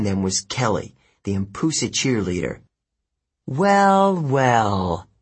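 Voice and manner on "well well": smug. Acted. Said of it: "Kelly said".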